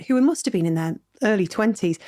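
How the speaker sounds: normal talking voice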